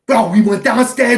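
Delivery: high, loud protest; mock protest. Firefighter defending himself to Woody, loud.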